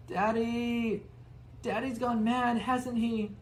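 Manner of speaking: put-on high child's voice; a spoiled boy asking his mother; high-pitched whiny boy; Dudley whining.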